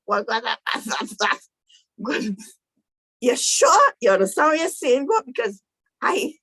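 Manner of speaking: stammering, broken words rising high; dumbfounded Moses. Retold or imitated. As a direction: imitate a man too stunned to finish his sentence. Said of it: "And Moses like".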